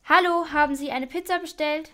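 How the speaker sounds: loud call through the door. Acted. Pizza man calls loudly at the door, calling out. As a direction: call out so they hear you inside.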